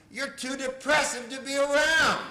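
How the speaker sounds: high, loud blurted comeback; blunt retort. Retold or imitated. Therapist answering a client's why, blunt shout.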